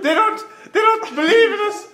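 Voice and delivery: high, loud Scottish wail; desperate Scottish guy. Acted. Scottish guy bemoaning his lot, desperate high Scottish accent. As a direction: plead loudly in a Scottish accent. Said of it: "His whole existence is desperate".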